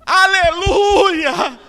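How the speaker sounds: very high, loud, long-drawn cry; belted one-word appeal; pleading holler. Retold or imitated. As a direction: stretch it out as if asking for help. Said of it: "com o endereço e disse".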